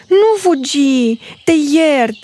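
put-on baby bear calls to the fleeing girl; little bear calling. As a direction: call out kindly after the runaway girl. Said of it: "Ursulică strigă".

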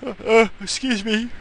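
talking through a yawn. Real, not acted. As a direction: yawn while excusing yourself. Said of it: "I can't stop yawning".

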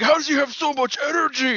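high, strained cartoon-villain voice; a baddie who can't believe it; imitating the villain's panic. Retelling the Buu saga, voicing the villain's high panic. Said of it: "he's like".

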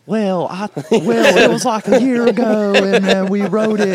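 high, slow, exaggerated Southern drawl; mock Southern accent. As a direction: imitate a bandmate with a drawn-out Southern twang. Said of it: "go in afterwards and imitate us".

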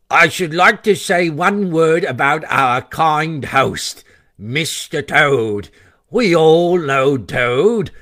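pompous after-dinner speaker, slowing on the name; drawn-out, ironic toast; speechmaking weasel. Acted. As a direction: put on a mocking toastmaster's voice.

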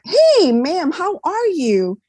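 high, bright mock-cheery hello; lightly voiced young soldier greeting her; cheerful greeting. A young amputee soldier cheerfully greets her on the steps, high and bright.

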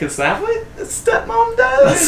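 very high shocked squeak; high squeaky voice. Retold or imitated. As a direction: pitch way up in disbelief. Lucas quoted, high squeak.

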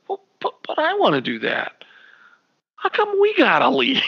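put-on whispered then high childish whine; whiny kid voice. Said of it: "I'm like".

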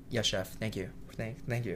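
humble thanks. Retold or imitated. Young cook answering his chef's praise, soft and humble. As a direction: murmur it, stumbling slightly on the thanks.

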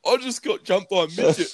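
high, laughing retold complaint; mimicking him laughing; mock whiny drunk quote. Friend retells his mate's complaint, laughing.

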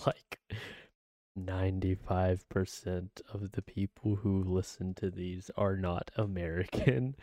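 usual speaking voice

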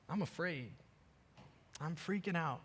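softly, with a pause between admissions; hushed confession to God. Retold or imitated. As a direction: confide it quietly, as in private prayer. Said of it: "Say things like what I said last night".

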